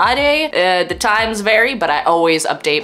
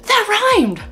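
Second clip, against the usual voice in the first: delighted surprise; 3 dB louder than usual. Real, not acted. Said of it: "Thank you, I know".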